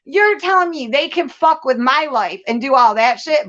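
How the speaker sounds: ordinary delivery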